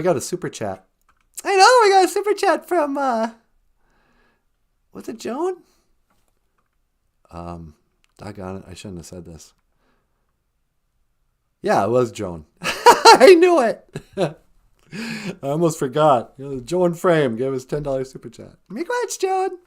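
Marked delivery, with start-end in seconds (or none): 1.4-5.6 very high, squeaky greeting; high cartoon voice. Greeting the host, squeaky and very high. 12.6-13.8 very high, squeaky greeting; high cartoon voice. Greeting the host, squeaky and very high. 18.7-19.6 very high, squeaky greeting; high cartoon voice. Greeting the host, squeaky and very high.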